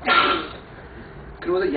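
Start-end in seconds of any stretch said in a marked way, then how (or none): 0.1-0.1 sudden loud yell at dawn; loud frustrated shout. Retold or imitated. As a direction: bark it out into the dark. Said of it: "뭘 얘기했냐면".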